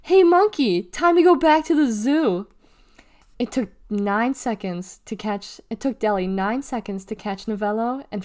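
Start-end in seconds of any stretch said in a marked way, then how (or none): 0.0-2.5 high, loud schoolyard taunt; loud, high-pitched jeer; teasing. A narrator's reading. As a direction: call it out to tease.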